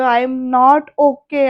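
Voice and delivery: each word dragged out very slowly and loudly; broken, slow and tearful. A narrator's reading. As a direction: say it slowly, like you are about to break down. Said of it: "तो रडायला लागतोय". (खूप हळू, रडवेल्या आवाजात)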